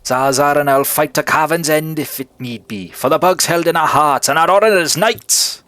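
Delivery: rousing bug knight. Acted. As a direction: swear it like a knight rallying troops. Knight vows to fight.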